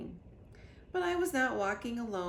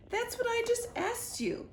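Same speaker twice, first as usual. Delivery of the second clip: much higher queenly voice; exasperated royal retort. A narrator's reading. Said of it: "said the Queen".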